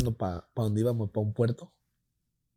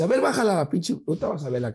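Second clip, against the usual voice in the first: mock telling-off, starting high; scolding sister; 6 semitones higher than usual. Sister orders brother to bring girlfriend out, scolding. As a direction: imitate a sister promising a scolding. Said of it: "le voy a poner una regañada".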